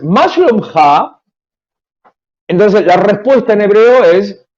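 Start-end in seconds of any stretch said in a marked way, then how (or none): 0.0-0.7 "מה שלומך?" said as Hebrew "ma shlomcha"; Hebrew phrase. Shown as a demonstration. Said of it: "la expresión en hebreo es".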